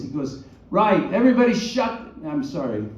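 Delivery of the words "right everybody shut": high, loud British director's outburst; British accent. Retold or imitated. Director silencing the film crew, loud British accent. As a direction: snap at everyone in a British accent. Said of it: "He has a British accent".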